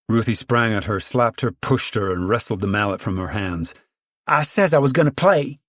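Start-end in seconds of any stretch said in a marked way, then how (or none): 4.3-5.6 put-on higher, louder gloating child; triumphant. A narrator's reading. Ruthie gloats after grabbing the mallet, triumphant. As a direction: crow over winning the mallet. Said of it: "she said triumphantly".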